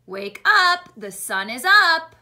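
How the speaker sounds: loud, lightly voiced rooster; rooster's wake-up call. Teacher reading the rooster's wake-up line, calling out loudly. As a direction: call out to rouse the farm. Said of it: "What do you think that he's saying?".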